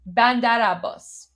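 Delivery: "Bandar Abbas" with the R rolling straight into the A, "Bandara-bbas"; run together as one word. Shown as a demonstration. Showing viewers to blend the two words, smooth and linked. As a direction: let "Bandar" flow into "Abbas" with no break. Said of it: "the R rolls into the A".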